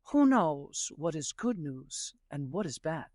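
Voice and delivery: a hint of a calm sage shrugging it off; wise old man. Old man answers his worried neighbor, lightly voiced and low.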